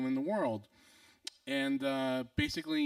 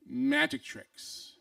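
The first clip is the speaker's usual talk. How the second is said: very slow, louder, higher; emphatic repeated reveal; 9 semitones higher, 5 dB louder, much slower than usual. Retold or imitated. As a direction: stretch each word out as a mock-grand revelation. Repeats the solemn reveal after disbelief.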